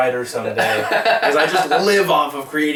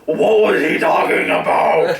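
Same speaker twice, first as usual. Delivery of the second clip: loud slow confused yell; confused shout; 5 semitones higher, 5 dB louder, slower than usual. Retold or imitated. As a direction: shout it bewildered. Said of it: "they're like".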